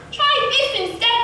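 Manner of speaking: prince's sharp, high shout; yelling back. A narrator's reading. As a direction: fling it back as a yell. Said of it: "the prince yelled back".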